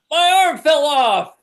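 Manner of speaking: mock panic. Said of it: "bones are disintegrating".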